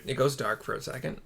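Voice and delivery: ordinary talk, nothing marked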